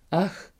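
short high "ach" before fainting; high cry of dismay. A narrator's reading. Queen cries out at the curse, high and sharp. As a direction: cry out in shock. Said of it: "krzyknęła królowa".

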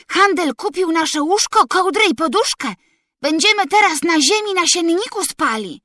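put-on high, eager bragging to the boys; excited shout. A narrator's reading. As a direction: boast it out across the yard. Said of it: "krzyknął Felek chłopakom". (podekscytowane, wysokie chwalenie się)

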